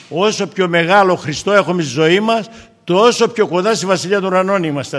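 preacher lifting his voice to stir the congregation; preaching at full force. Acted. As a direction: proclaim it to the whole church.

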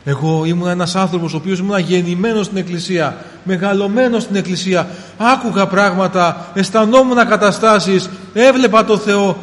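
full-force sermon voice; fervent preaching. Acted. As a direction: preach it with rising fire. Preacher's climax.

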